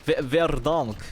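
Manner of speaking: slow Verdansk, the a like o, Russian accent; russian accent in german. Shown as a demonstration. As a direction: draw out Verdansk as a Russian would. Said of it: "muss man das russisch aussprechen?". (Verdansk langsam mit russischem Akzent)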